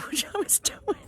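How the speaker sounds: soft, high words through laughter; laughing. Real, not acted. Picturing herself climbing the rock, laughing. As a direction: crack up while repeating it. Cracks up at her own visual.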